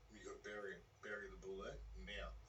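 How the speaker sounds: hushed, low-key command; soft, insistent imitated inner voice; whispering inner voice. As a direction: murmur the order like a voice inside the head. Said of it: "whispers to him".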